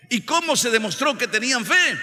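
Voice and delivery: high, loud rhetorical question; loud pulpit challenge; full-force preaching. Real, not acted. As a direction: fire the question at the congregation.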